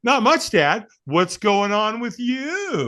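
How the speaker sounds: put-on a boy who already knows the news, teasing; knowing kid. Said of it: "He goes".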